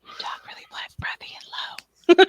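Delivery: very soft breathy whisper; library whisper. Shown as a demonstration. Trying out the co-host's lesson, library whisper. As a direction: copy the ASMR hush, barely audible. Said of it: "I feel like I'm whispering in the library".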